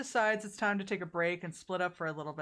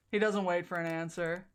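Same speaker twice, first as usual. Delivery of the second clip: ordinary talk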